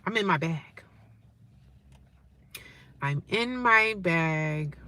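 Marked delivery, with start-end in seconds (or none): 3.0-4.8 very slow, stretched words, softer; drawn-out. Real, not acted. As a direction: savour each word slowly. Same line repeated very slowly.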